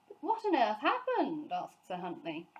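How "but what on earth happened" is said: higher, louder bewildered ask; baffled question. A narrator's reading. Sir Huntley baffled.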